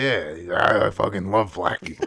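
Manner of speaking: a hint of Sean Strickland, said deadpan; Sean Strickland impression. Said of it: "That's a good impression".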